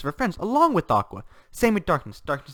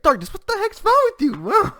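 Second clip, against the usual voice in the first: Megumin; 8 dB louder than usual. Retold or imitated. Acts out Megumin scolding Darkness.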